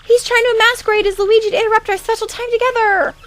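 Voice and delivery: very high, squealing disgust; disgusted fangirl. Acted. Fangirl creeped out by a supposed imposter, disgusted.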